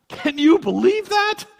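high, loud, scandalized gasp of a question; mock outrage. A self-righteous neighbor griping about a lawn in a drought, high and loudly scandalized. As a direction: sneer at the neighbor like a gossip.

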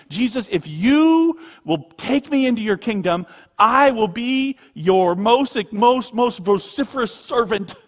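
high, loud, drawn-out mock vow; mock grand pledge. Preacher imagining a pledge the thief never made, overblown and loud. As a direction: proclaim it slowly and hugely, overblown.

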